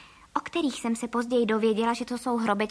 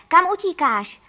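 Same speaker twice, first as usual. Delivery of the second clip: loud, raised shouted question; calling out; 6 semitones higher, 9 dB louder than usual. A narrator's reading. Mr. Čejka shouts after the narrator running to the lake, calling out. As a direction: call after someone who is running away. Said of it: "křikl za mnou".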